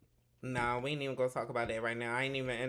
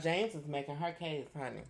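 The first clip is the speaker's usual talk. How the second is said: normal talking voice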